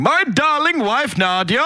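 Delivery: high, slow theatrical proclamation; Laszlo. Retold or imitated. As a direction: declaim it grandly with warped pronunciation. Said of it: "mispronouncing things on purpose".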